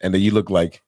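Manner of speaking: ordinary delivery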